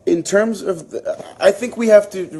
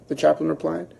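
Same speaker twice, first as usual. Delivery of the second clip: plain reading voice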